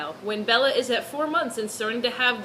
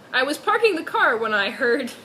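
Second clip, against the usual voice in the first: normal talking voice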